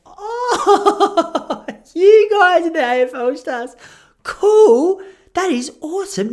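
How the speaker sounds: high, loud, laughing overdone delight; squealing mock delight with a laugh; fake enthusiasm. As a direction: cover the surprise with a big laughing gush.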